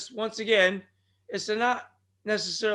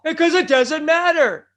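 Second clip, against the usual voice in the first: much higher, booming yell of the catchphrase; sudden shout; 8 semitones higher, 11 dB louder than usual. Acted. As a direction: belt it out all at once. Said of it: "so I do shout every once in a while".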